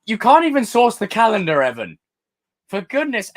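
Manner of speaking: "can't" high and loud, mock outrage at Evan; mock exasperated scold. Real, not acted. Streamer mock-scolds Evan for the calendar, loud.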